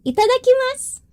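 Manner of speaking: high, bright cry of "Itadakimasu"; gleeful high call; excited. Real, not acted. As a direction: sing it out before the first sip. Excited before drinking.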